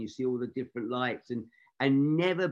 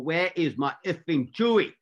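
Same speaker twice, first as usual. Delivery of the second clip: retold angry demand in a Scottish accent, higher and louder; impatient angry director; 6 semitones higher, 5 dB louder than usual. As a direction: snap it out like a fed-up director on the radio. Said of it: "this voice on the radio getting more".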